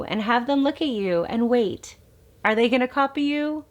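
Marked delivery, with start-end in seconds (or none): none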